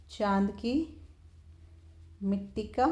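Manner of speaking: रुक-रुक कर धीरे बोलना; dictating slowly. Shown as a demonstration. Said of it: "repeats the phrase in pieces".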